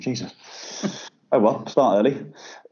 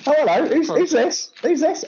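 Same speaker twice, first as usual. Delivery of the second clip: mock red carpet host.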